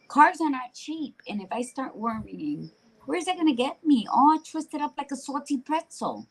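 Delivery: ordinary delivery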